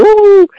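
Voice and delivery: high, drawn-out ghostly "ooh"; mock spooky ooh. Teasing a scared man about creatures coming, mock spooky ooh. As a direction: tease with a mock-ghost wail.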